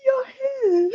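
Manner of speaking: high, soft, mock-pitiful whimper. Imitating his reaction to her hair, whimpering. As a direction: whimper it, squeezed by laughter. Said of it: "It was like a whimper".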